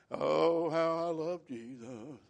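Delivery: slow, dragged-out, listless sing-song; mopey, dragging worship. Retold or imitated. Mocking churchgoers who drag through worship, slow and listless. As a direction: drag the words like you barely care. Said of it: "That's about the way we look sometimes".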